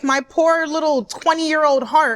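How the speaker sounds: ordinary talk, nothing marked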